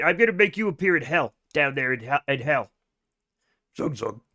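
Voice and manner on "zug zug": orc zug zug. Retold or imitated. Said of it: "World of Warcraft orcs".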